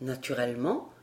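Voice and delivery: low, soft, would-be pleasant reply; forced amiability. A narrator's reading. The tutor trying to please Barbara, forced amiability. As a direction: try to sound agreeable, low and soft. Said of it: "en s'efforçant de rire pour paraître aimable".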